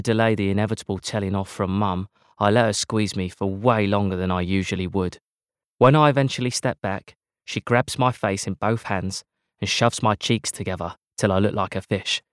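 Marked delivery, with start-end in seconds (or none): none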